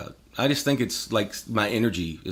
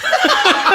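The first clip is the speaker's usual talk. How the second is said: high burst of laughter; 8 dB louder than usual. Real, not acted. Laughing at the son's swearing.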